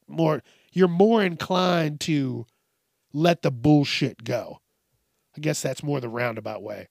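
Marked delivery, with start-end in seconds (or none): none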